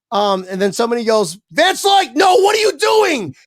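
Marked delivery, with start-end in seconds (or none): 1.5-3.4 high, loud yelled warning; mock alarmed shout. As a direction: yell it in a panic like a stranger. Someone yelling at him at lift.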